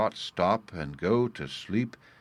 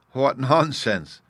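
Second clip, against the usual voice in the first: slow, high, louder cry of mock scorn; 5 semitones higher, 7 dB louder, much slower than usual. A narrator's reading. Sylvie cries out at the dreamer, amused and scornful. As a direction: stretch it out, laughing at the idea. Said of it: "she cried".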